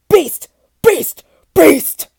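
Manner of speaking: high, very loud escalating roars; roaring beast. Acted. Beast howls his own name.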